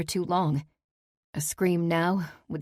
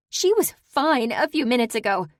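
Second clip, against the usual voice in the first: higher, louder, furious; furious accusing cry; 8 semitones higher, 5 dB louder than usual. Acted. Daughter blames Saber for the death, high angry cry. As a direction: hurl it as an accusation.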